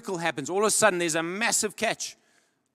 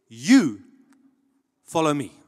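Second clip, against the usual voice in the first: YOU hit hard and loud, long pause before "follow me"; emphatic command; 3 semitones lower, 8 dB louder, slower than usual. Retold or imitated. Jesus tells each one of us, emphatic and loud. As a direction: punch "You", wait, then a firm "follow me".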